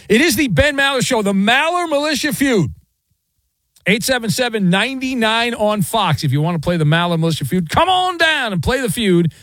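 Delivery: big announcer call-out; radio game announcer. Acted. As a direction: sell the contest like a game-show host.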